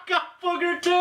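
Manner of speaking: laughing high, squealing. Real, not acted. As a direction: shriek it through laughter. Both got gross flavor.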